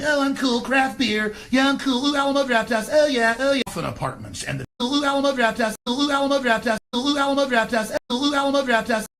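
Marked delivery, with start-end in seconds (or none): 0.0-3.6 high, smug hipster voice, pitched up; prissy trendy hipster cooing over craft beer; sneering parody of a smug hipster; mock hipster. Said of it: "walking around going". 4.8-9.1 high, smug hipster voice, pitched up; prissy trendy hipster cooing over craft beer; sneering parody of a smug hipster; mock hipster.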